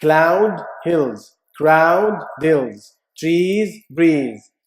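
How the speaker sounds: each rhyme word hit and falling; rhyme words stressed. Shown as a demonstration. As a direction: lean on the rhyming sounds. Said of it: "إيش نظام الرايمنج هنا".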